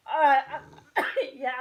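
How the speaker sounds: words squeezed out through laughter; talking through a laugh. Real, not acted. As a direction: still cracking up, loud. Still laughing.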